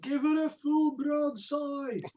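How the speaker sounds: high, slow, drawn-out call; yelled order. Acted. Silas yells out to Haldir, drawn-out yelled order. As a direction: call a firing order across to another ship. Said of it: "can Silas yell out to Haldir".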